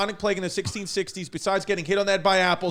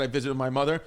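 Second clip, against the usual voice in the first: ordinary delivery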